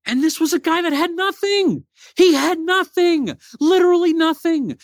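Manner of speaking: high, impassioned motivational preaching, slowing on key words; rally the listeners with a fervent sermon; passionate preaching. Acted. Inspirational message to the audience.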